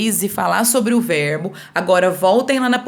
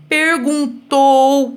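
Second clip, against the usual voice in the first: PERGUNTOU slow, raised, last syllable held; drawn-out example word; 5 semitones higher, 4 dB louder, much slower than usual. Shown as a demonstration. Teacher giving pupils another verb example, drawn-out emphasis. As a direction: stretch PERGUNTOU out deliberately.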